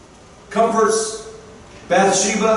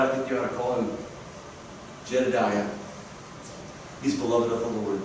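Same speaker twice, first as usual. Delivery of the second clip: much softer and lower, tender; tender hush; 9 semitones lower, 9 dB quieter, faster than usual. Acted. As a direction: hush it like a gentle, loving scene. Tender scene of naming Solomon.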